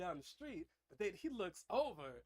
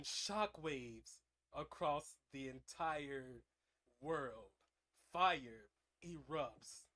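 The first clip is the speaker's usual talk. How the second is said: slow, low dramatic storytelling; epic slow narration; 5 semitones lower, slower than usual. A narrator's reading. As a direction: draw out each word like a trailer.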